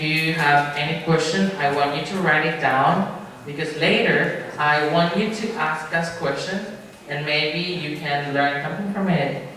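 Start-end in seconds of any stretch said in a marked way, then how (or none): none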